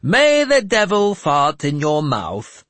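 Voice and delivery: high, loud, slow and lofty curse. Acted. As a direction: bless them with a curse from on high. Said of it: "Jeremias said loftily".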